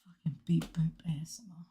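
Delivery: fully whispered, very soft; ASMR whisper. Shown as a demonstration. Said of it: "Sure, let me".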